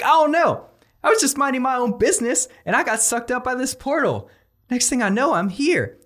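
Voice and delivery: high-pitched, hapless everyman voice; bewildered newcomer. Acted. Ordinary Peter Parker explains being sucked in, bewildered high voice.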